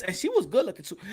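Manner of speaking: usual speaking voice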